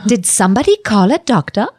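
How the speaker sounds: put-on Swiss-accented game heroine delivering her line; Mercy. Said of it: "can I hear her voice live".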